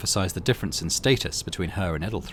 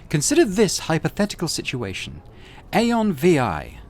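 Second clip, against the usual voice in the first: imperious rogue trader; 8 semitones higher than usual. Acted.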